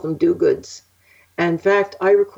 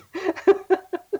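laughing; 12 semitones higher than usual. Real, not acted. Laughs at the story's end.

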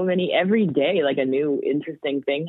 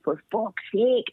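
put-on drawn-out, higher toddler voice, Scottish accent; little Scottish girl; 6 semitones higher than usual. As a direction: quote a small child's Scottish-accented swearing. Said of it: "she has a little Scottish accent".

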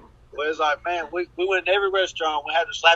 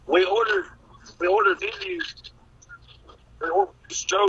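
ordinary talk, nothing marked

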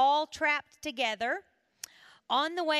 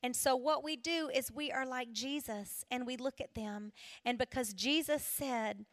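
usual speaking voice

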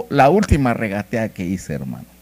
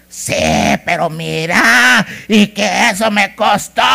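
loud, high, drawn-out scolding; mock marital tirade at full volume; venting husband; 10 semitones higher, 9 dB louder, slower than usual. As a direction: rant it like a husband unloading his venom. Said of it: "hasta que descargan todo su veneno".

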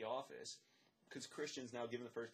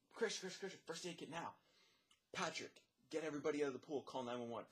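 higher-pitched urgent calls, not really shouted; urgent orders; 4 semitones higher than usual. Retold or imitated. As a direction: fire off the orders like a lifeguard. Retold urgent orders in an emergency.

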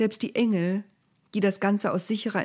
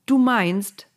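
objecting; 5 semitones higher, 7 dB louder than usual. A narrator's reading. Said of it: "wandte Mose ein".

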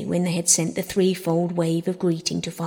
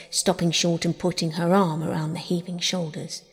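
ordinary delivery

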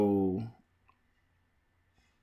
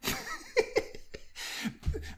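breathless laugh; 8 dB quieter than usual. Real, not acted. Sibling mocks competitiveness.

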